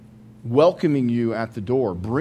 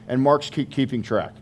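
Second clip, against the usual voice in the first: normal talking voice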